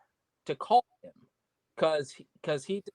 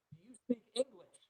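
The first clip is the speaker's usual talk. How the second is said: imitated phone questioner; 8 semitones higher, 9 dB quieter than usual. Voicing the wrestler on the phone.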